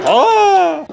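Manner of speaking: sudden high-pitched cartoon scream; high yelp. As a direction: let out a squeaky shriek as he's tossed. Blocky thrown out, screams.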